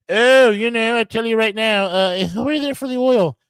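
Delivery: high-pitched parody of oil-war talk; mocking quote. Mocking talk he says to laugh at.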